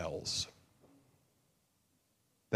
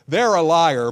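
mock accusation; 6 semitones higher, 12 dB louder than usual. Mocking self-justifying accuser.

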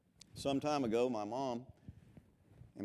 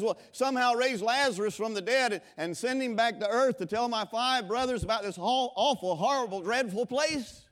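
rich man crying out; 10 semitones higher, 9 dB louder than usual. Retold or imitated. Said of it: "the rich man cries out".

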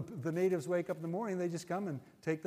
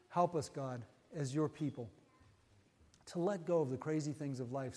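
heartfelt prayer. Real, not acted. Pastor praying over his congregation, earnest prayer voice. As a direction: pray it out for everyone listening.

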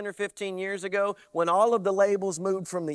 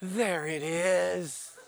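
mock Jesus approval; 3 dB quieter, much slower than usual. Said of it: "as if Jesus goes".

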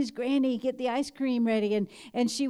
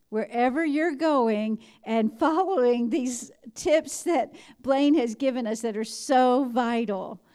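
ordinary delivery

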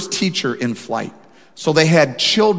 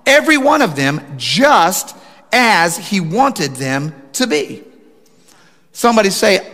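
louder, higher preaching build; 4 semitones higher, 3 dB louder than usual. Acted. Pastor preaching to his church, impassioned. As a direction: drive the point home to the congregation.

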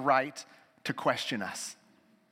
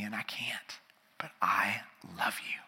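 soft whisper, much lower; whispered confession; 7 semitones lower, 6 dB quieter than usual. Acted. Peter confesses to the Lord in a whisper. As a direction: breathe it out like a humble confession.

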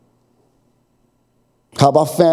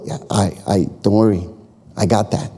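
lower, softer reassurance; a hint of God calming a fretful believer; 5 semitones lower, 4 dB quieter than usual. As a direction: settle them gently, no worry. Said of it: "God answers".

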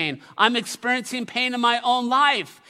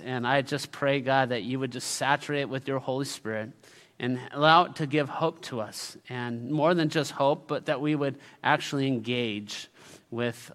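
prayer; 6 semitones lower than usual. Acted. Prayer before the sermon.

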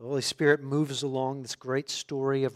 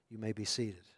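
ordinary talk

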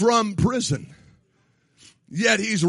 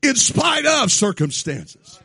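loud, high, shouted sermon cadence; full-force preaching; 7 semitones higher, 7 dB louder than usual. Acted. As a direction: preach it out to the whole room. Preacher driving home joy.

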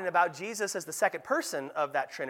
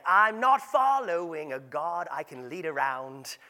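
mock Australian accent, drawn out. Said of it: "sounds cooler in the Australian accent".

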